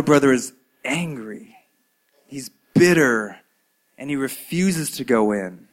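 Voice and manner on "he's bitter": ordinary delivery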